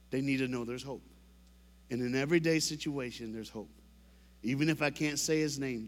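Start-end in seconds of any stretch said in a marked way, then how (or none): 3.3-3.7 soft sincere. Real, not acted. Sincere message to kids.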